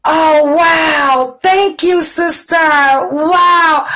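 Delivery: high, loud delighted gasp of thanks; real happy surprise at a kind gift; excited thanks. Real, not acted. Host thanking a sister for a gift, excited and delighted.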